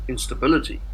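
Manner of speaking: ordinary delivery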